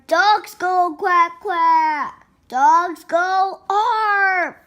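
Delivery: slow, loud, stretched animal sounds; mother correcting. Acted. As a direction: lecture the pup with drawn-out emphasis. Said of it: "said George's mother".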